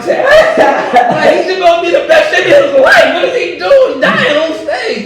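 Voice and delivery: loud, high, laughing protest; mock the role with a loud laugh; laughing outburst. Real, not acted. Telling friend about his silly role, loud laughing.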